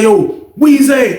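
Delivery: high, drawn-out hail; calling out. Retold or imitated. Angry client summoning the teller over, calling out. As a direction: stretch the name to summon someone.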